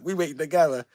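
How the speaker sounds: ordinary delivery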